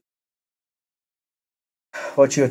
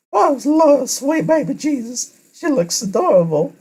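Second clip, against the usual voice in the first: squealing high gushing voice; 5 dB louder than usual. Acted. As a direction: gush over her like a fangirl. Character gushing over cute outfit.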